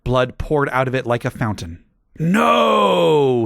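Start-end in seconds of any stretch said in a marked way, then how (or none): 2.2-3.4 long loud high cry; stabbed villain screaming; hammed-up scream. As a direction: scream as he dies. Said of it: "he screamed".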